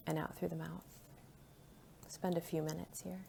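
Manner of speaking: soft, hushed breathing guidance; quiet, calm meditation voice; guided meditation. Real, not acted. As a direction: lead the breath gently, leaving space between phrases. Guides silent counted breathing.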